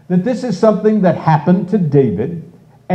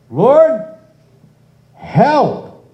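high, louder cry for help; desperate plea; 9 semitones higher, 3 dB louder than usual. Retold or imitated. Preacher acting David's desperate cry to the Lord. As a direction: call out to the Lord in need. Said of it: "And he says".

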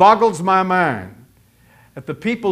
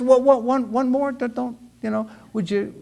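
high, stammering, trailing-off mock plea; timid pleading; 6 semitones higher than usual. Gideon daring to ask God once more, timid.

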